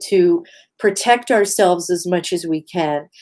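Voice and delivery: normal talking voice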